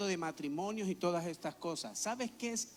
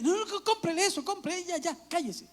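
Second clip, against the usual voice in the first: much higher, loud, giving in to a tantrum; frantic parent; 11 semitones higher, 6 dB louder than usual. Retold or imitated. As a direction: cave in fast to make the crying stop. Parent caving in at toy store. (madre desesperada cediendo, mucho más aguda)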